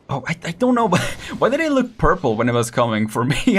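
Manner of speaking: normal talking voice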